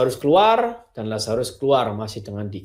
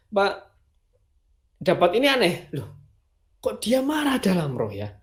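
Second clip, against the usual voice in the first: normal talking voice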